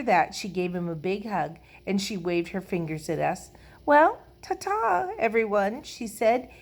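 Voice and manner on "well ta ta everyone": airy farewell. A narrator's reading. Lucille waves goodbye to the children, airily. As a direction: trill a grand ta-ta. Girl bids grand goodbye.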